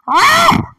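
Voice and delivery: high, loud dragon screech; short piercing creature shriek; fierce screech. Acted. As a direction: let out a fierce screeching cry. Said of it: "that was a fierce screech".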